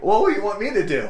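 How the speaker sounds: high, loud and fast; flustered excitement. Retold or imitated. Canoe partner panicking with a fish on, flustered and fast. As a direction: blurt it out in a fluster. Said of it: "I'm all excited".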